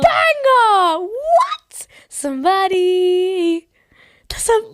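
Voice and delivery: high, loud excited shouting. Real, not acted. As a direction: shout it with delight. Excited shouting about song.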